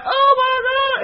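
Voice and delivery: very high squeal of delight; hammed-up, high-pitched rapture; food ecstasy. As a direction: cry out as if it's the best bite ever. Acted rapture at eating shrimp.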